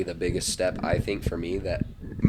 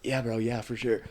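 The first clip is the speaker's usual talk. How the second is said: higher, mock casual reply while winded; hiding breathlessness; 4 semitones higher than usual. Runner chatting with running partners, trying not to sound winded. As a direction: act out covering up being out of breath. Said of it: "trying not to be so out of breath".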